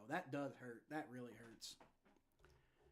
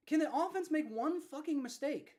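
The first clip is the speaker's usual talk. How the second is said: angry outburst; 9 semitones higher, 4 dB louder than usual. Real, not acted. Fan angry at the defense.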